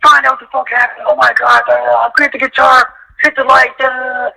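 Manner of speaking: high, frantic panic; mock panicked production crew barking orders. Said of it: "the production got like".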